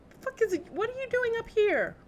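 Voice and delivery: high, loud startled outburst. Real, not acted. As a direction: jump and yell in surprise. Startled by enemy in game.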